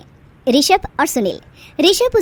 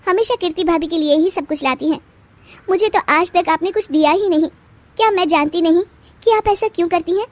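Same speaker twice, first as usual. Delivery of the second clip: higher, bitter complaint; a young bride's higher voice, complaining to her mother-in-law; aggrieved young wife; 6 semitones higher than usual. Acted. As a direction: let out all the hurt. Bride airs her grievances.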